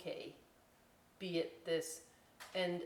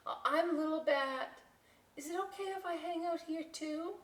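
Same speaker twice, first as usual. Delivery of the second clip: high, curious question; high curious little bat; 9 semitones higher than usual. Acted. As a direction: peek in and ask.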